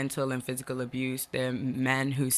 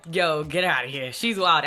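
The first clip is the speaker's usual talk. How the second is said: loud, hammed-up dismissal; playful loud kick-out; mock exasperation; 3 semitones higher, 7 dB louder than usual. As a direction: imitate fed-up parents, raised voice. Acting parents sending her away.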